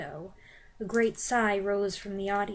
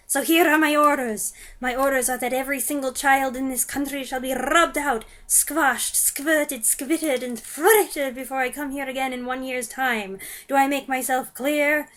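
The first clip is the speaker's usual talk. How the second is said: put-on higher, louder accented commands; witch giving orders; 4 semitones higher, 8 dB louder than usual. Grand High Witch ordering witches to destroy children. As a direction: lay out the orders like a tyrant.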